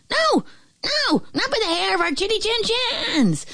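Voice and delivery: very high, squealing piglet voice; little pigs. A narrator's reading. Pigs refuse to let the wolf in, high squeal. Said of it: "The pigs grunted".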